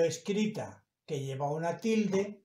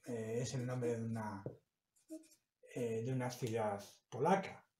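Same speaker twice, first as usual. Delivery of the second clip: ordinary delivery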